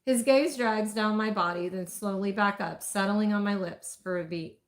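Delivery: ordinary delivery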